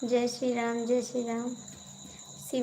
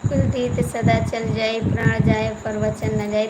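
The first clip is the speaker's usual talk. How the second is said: louder proclamation of a proverb; declaimed saying; 9 dB louder than usual. Acted. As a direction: announce the vow like a devotional line.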